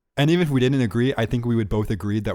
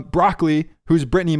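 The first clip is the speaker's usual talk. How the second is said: normal talking voice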